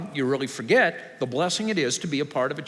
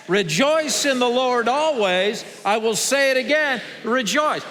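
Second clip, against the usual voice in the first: loud, high, slow recitation, evenly driven; rally the congregation to say it louder; out loud together; 10 semitones higher, 4 dB louder than usual. Shown as a demonstration. Pastor making the church retry the verse, loud and high. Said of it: "Let's try it again".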